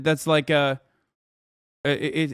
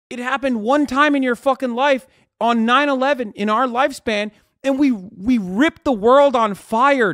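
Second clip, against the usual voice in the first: high, loud heated rant; real outrage, voice high and loud; angry shouting; 7 semitones higher, 4 dB louder than usual. Real, not acted. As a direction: hammer the point home, furious. Heated rant about Americans.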